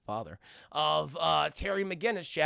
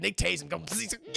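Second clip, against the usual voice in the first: ordinary delivery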